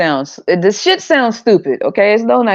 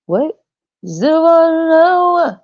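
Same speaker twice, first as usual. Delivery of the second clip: mock disbelief; 11 semitones higher, much slower than usual. Mock incredulous reaction.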